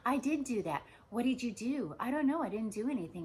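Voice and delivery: loud, high mock argument; super defensive. Both sides of a quarrel over who did it, defensive. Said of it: "you're super defensive".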